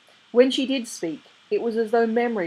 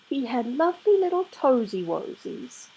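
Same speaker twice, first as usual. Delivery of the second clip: put-on higher, cooing over a remembered baby; fond baby talk; 7 semitones higher than usual. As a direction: dote on the tiny toes. Mary fondly recalls the baby.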